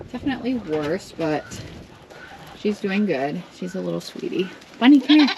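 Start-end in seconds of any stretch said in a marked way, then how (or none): 4.8-5.3 loud call across the pen; loud calling to the goat. Real, not acted. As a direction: call the goat to come over.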